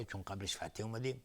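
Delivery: ordinary talk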